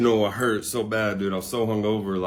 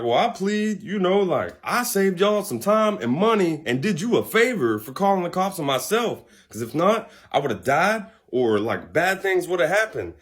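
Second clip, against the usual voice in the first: higher-pitched, earnest mock self-defense; retold drunken plea; 7 semitones higher than usual. Drunk defendant justifying himself to judge, mock earnest plea. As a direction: act out arguing your case in court.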